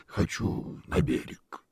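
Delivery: slow, breathy bubbling creature; gurgling alien voice. Acted. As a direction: gurgle the words like a sea monster. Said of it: "пробулькал голос Бондаря".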